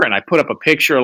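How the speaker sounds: ordinary talk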